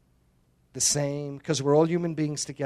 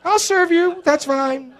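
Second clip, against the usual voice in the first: mock meek surrender; 12 semitones higher, 4 dB louder, slower than usual. Joking voice of reluctant believer.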